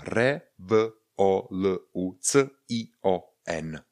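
separate, evenly spaced letter sounds; spelling out. Shown as a demonstration. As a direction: sound by sound, a gap after each letter. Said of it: "píšeme cion. To znamená".

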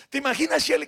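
ordinary delivery

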